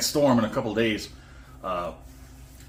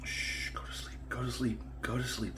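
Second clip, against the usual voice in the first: whispering over a crib; 12 dB quieter than usual. Real, not acted. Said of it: "Had to put the crying baby to sleep".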